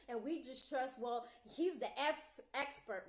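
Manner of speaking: ordinary talk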